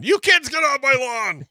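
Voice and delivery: high, loud grumpy homeowner shout; mock angry yell. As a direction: holler at kids on the lawn, hammed up. Mock yelling at trespassing kids.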